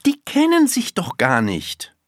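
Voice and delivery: put-on high child's voice, objecting; insistent little girl. A narrator's reading. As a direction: state the objection as a small girl. Girl argues, first take.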